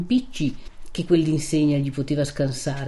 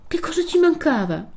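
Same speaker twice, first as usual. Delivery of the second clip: high, loud, wounded protest; hurt, emphatic remonstrance; impassioned reproach; 11 semitones higher, 5 dB louder than usual. A narrator's reading. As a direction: plead indignantly, pitch pushed right up. Renzo reproaches Lucia over promises.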